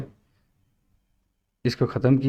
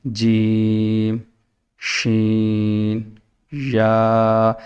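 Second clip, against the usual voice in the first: each letter stretched out very slowly; 3 semitones lower, much slower than usual. Shown as a demonstration. Teacher showing pupils the right way, very slow and stretched. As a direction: draw out every letter, no hurry. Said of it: "یعنی کہ آہستہ".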